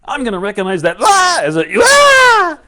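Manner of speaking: high, loud, with yelled blahs; excited recognition. Acted. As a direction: declare it, shrieking the nonsense word. Acting blah turned into excitement.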